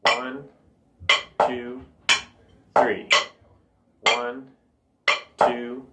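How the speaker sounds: widely spaced, even numbers; counting in three. Shown as a demonstration. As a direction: count a steady, unhurried three over the rhythm. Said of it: "And I can count three".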